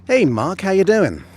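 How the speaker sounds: loud, high, cheerful hello; excited greeting. Real, not acted. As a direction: greet the viewer with delight. Greeting viewer loudly.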